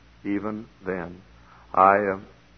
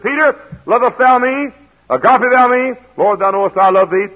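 preaching at full force; 13 semitones higher, 11 dB louder than usual. Acted. Preacher acting out Jesus questioning Peter, loud and high.

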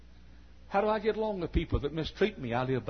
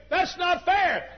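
mock complaint to God; 13 semitones higher, 6 dB louder, slower than usual. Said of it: "you look to God and say".

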